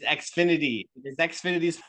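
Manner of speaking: choppy robotic connection voice; lagging robotic audio. Real, not acted. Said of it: "the whole time it was robotic".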